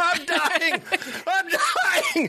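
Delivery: high-pitched wail of "dying"; mock dying cry. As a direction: wail it high, hammed-up, through laughter. Hammed-up cry of dying amid laughter.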